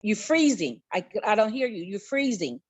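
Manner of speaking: ordinary talk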